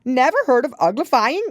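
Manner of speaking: be flabbergasted she never heard of it; astonished Gryphon. A narrator's reading. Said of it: "it exclaimed".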